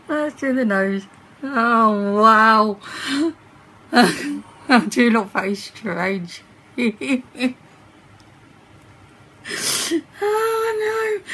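talking through a wet mask; wearing a sheet face mask. Real, not acted. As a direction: face covered while laughing. Seen 1: Wet animal-print sheet mask covers the face throughout; mouth opening left uncovered.

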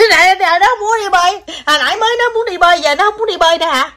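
high, teasing fake-cry voice; mock a whiny child in a squeaky high wail; mock whining. Mother teasing child who refuses to swim, mock crying.

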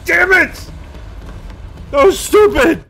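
angry self-scolding shout; frustrated shout. Real, not acted. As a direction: blow up at your own blunder. Furious at his own mistake.

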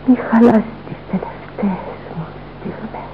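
dying whisper; fading whisper. Acted. Dying mother begging her son, in a fading whisper. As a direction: breathe it out as life fades. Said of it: "η φωνή μου σφήνει".

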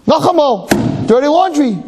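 high, drawn-out scandalised wife; wife complaining. Acted. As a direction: stretch the name out in disgust. Wife complains again next morning.

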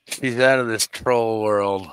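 usual speaking voice

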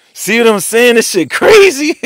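high, loud disbelieving shout; excited shout. Real, not acted. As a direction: yell it out, can't believe it.

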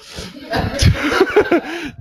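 high embarrassed giggle; nervous giggle. Real, not acted. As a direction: giggle when the words fail. Laughs when words fail.

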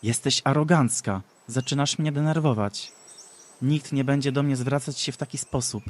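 angry rebuke; annoyed. Acted. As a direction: tell her off, getting angry. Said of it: "Zdenerwowana Ashera".

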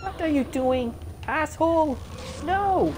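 loud, high shout at the enemy; angry yell. Real, not acted. Gamer yelling at the boss attacking him, angry shout. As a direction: snap at him in real anger.

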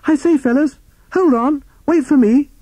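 high little toad shouting to catch up; Toad calling out. A narrator's reading. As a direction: call after friends in a tiny high voice. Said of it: "Timid Toad's voice call from".

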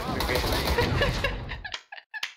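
high helpless laugh. Real, not acted. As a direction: crack up in a long high-pitched laugh. Laughing at failed accent.

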